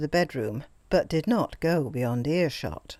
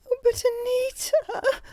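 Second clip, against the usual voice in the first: much higher, slow, crying plea; wailing. A narrator's reading. Greta pleading with Anita, a high tearful wail. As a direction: wail her name in tearful protest. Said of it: "wailed the other".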